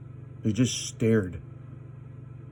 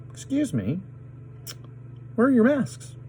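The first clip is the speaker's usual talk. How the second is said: rising, much higher challenge; challenging; 13 semitones higher than usual. Retold or imitated. Confronting maskless shoppers, voice climbing high. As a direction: call them out, pitch climbing high.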